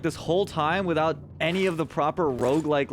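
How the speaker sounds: ordinary talk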